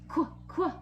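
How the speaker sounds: "Qu, qu" as crisp kw sounds; letter sound qu. Shown as a demonstration. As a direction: say "Qu" as a short kw sound. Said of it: "Can you guys say that sound?".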